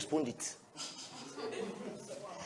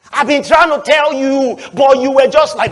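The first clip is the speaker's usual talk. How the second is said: usual speaking voice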